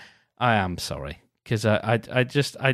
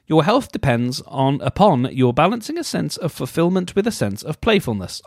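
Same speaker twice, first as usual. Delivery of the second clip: measured read-aloud voice, a touch higher; mock-earnest reading; 5 semitones higher, 3 dB louder than usual. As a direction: read it like a horoscope entry. Rereading the quote properly.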